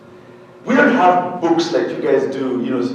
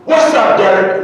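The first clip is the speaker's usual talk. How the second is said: high, loud brash hello; mock loud Pittsburgh woman; loud American greeting; 5 semitones higher, 6 dB louder than usual. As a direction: burst in with a brash hail. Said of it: "she busts in and says".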